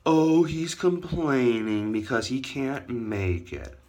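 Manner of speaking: high, slow, drawn-out taunt; mocking critics. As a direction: imitate scoffers, stretching every word. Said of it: "everyone's gonna go like".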